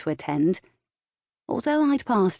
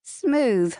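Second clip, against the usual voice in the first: higher-pitched playful tease; teasing; 6 semitones higher than usual. A narrator's reading. Partner ribbing boyfriend's crude line, teasing. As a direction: lift it, lightly mocking. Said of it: "I teased".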